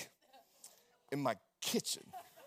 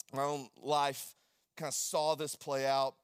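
ordinary talk, nothing marked

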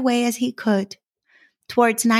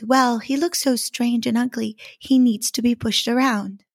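plain reading voice

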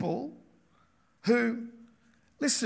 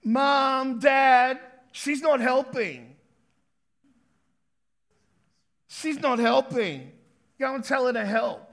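put-on loud, higher tattling child calling mom and dad; child complaining to parents about a sibling; whiny child; 4 semitones higher, 6 dB louder, slower than usual. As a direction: tattle like a kid. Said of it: "Haven't we all done that".

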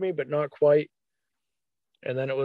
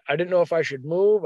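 ordinary talk, nothing marked

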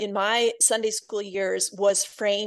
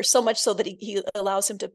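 usual speaking voice

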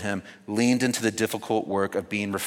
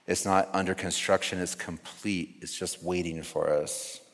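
calm grounding exercise; 3 semitones lower, 5 dB quieter than usual. Acted. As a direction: guide them softly into silence. Guided reflection continues.

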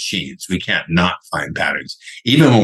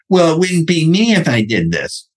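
higher, put-on whiny excuse voice; mocking imitation; 10 semitones higher than usual. Someone excusing staying in their comfort zone, mocking imitation. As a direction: mimic the excuse in a raised voice.

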